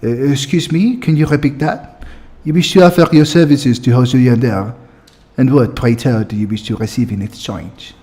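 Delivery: put-on haughty French-accented questioning. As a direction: play them as French snow elves, French accent. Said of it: "give them all a French accents".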